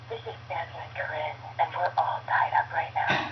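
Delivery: mom's suggestive phone greeting; sultry answering machine. Acted. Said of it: "our answering machine was".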